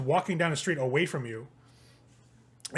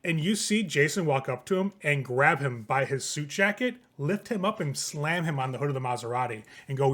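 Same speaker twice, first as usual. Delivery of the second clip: usual speaking voice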